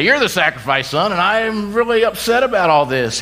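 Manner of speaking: high, strained mock complaint; hammed-up upset father. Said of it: "Abraham didn't look and say".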